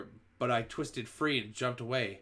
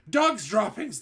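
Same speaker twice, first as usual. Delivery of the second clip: much higher, loud shout; 10 semitones higher, 8 dB louder, slower than usual. A narrator's reading. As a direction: yell it across the room. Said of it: "they shouted".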